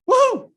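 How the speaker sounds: high, loud celebratory whoop; excited woo-hoo. Real, not acted. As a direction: let out a sudden, piercing cheer. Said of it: "sorry about my woo-hoo".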